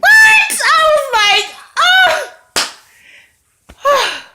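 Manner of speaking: very high, loud, drawn-out squeal; screamed reaction. Retold or imitated. Viewer reacting to 'I left my wife', screamed reaction. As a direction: let out a long, over-the-top shriek. Acts out reaction to love confession.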